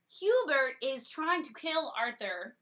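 usual speaking voice

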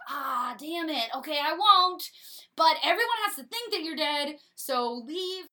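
caving in with a grumble; giving-in Hubert. Retold or imitated. Hubert giving in to Arthur, mildly exasperated. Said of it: "And Hubert's like".

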